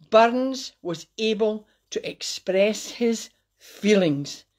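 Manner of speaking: slow, spaced-out delivery; deliberately slow for the listeners; slowly. Shown as a demonstration. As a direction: say it slowly, word by word. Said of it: "I will say this slowly".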